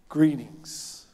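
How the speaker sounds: warm Greetings, said with care; tender greeting. Shown as a demonstration. Preacher voicing Jesus greeting the women: tender, gentle Greetings. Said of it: "tender and gentle greeting of a friend".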